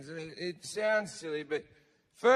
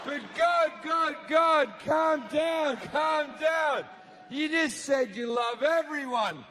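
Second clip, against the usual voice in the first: pleading crowd; 7 semitones higher than usual. Acted. Partygoers calming God down.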